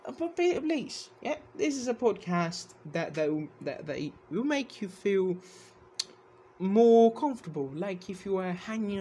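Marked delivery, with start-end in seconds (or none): none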